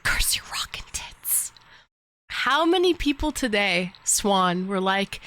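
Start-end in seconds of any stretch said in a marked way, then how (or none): 0.0-1.5 whisper. Pretend. Whispered curse at dog.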